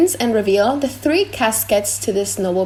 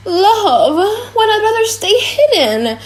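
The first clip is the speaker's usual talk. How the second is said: pleading; 11 semitones higher than usual. Acted. Jessica pleads, high pitched.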